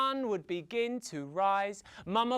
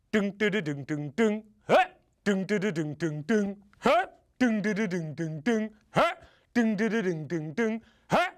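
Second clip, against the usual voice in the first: imitated rumbling sound; 4 dB louder, faster than usual. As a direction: drum out a mysterious noise, puncturing it with "huh". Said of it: "she heard a sound".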